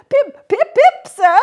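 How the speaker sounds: put-on frightened boy. A narrator's reading. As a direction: squeak out your name in fear. Boy shouts name.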